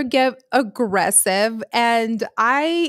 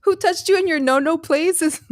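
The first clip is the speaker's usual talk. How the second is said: laughing; 7 semitones higher than usual. Real, not acted. Repeating joke while laughing.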